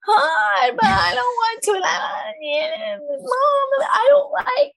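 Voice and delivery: put-on high, slow whiny kid voice, near crying; whining child. Mom imitating her whining daughter, high drawn-out whine. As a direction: whine it like a five-year-old in a tantrum. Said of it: "everything is just like".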